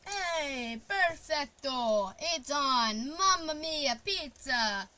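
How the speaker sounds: put-on hammy Italian accent, slow perfecto; over-the-top Italian chef exclaiming; jolly Italian pizza chef. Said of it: "I don't know why I said that".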